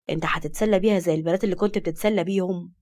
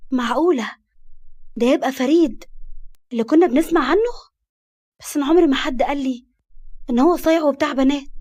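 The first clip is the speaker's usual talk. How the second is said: stunned disbelief, pitched up and louder; shocked; 5 semitones higher, 3 dB louder than usual. A narrator's reading. Karma learns the flirt is the famous Farid, shocked. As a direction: blurt it out, astonished at who he is. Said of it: "وهي قالت بصدمة".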